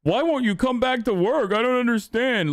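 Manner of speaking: high, whiny baffled boss voice; mock owner whining that workers won't come back; mocking business owners. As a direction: play a clueless owner who can't get it. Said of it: "They're like".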